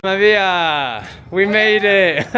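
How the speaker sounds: loud, high excited call to Maria; thrilled shout, laughing at the end. Real, not acted. Excited call to Maria on arrival.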